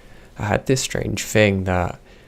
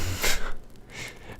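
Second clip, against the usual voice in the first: soft laugh; 7 dB quieter than usual. Real, not acted. Laughs after Forrest Gump joke.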